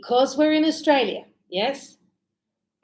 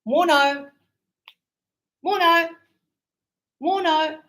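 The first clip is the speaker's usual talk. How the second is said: Aussie "morno"; 6 semitones higher than usual. Retold or imitated. Teacher imitates workers' short morning greeting, high Aussie. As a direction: toss it off like a builder at dawn. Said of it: "and they go".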